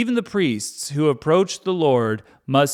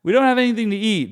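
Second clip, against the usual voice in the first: high, louder whine; mock the grumbling people; hungry Israelites nagging Moses, hammed-up whine; whiny complaining crowd; 8 semitones higher, 5 dB louder, faster than usual. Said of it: "they're always like".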